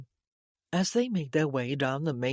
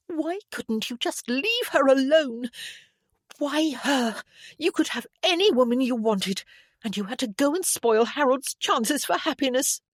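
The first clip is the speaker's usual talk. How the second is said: high, loud, upset tirade; angry accusation; 9 semitones higher, 5 dB louder than usual. A narrator's reading. Sobbing woman blames Damien, high angry outburst. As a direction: lash out in wounded protest. Violet rails at Damien in tears.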